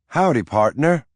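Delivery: lightly voiced Irish nanny greeting; Irish accent. A narrator's reading. Irish nanny teasing her employer, in a light Irish accent. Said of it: "she said in her Irish accent".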